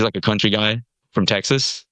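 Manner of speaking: usual speaking voice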